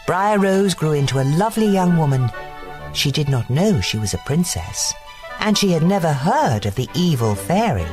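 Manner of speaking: ordinary delivery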